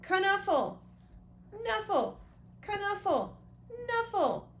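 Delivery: put-on high, loud little-kid repeating of the name; high child voice. Toddler Trixie calling for her bunny in a high child voice.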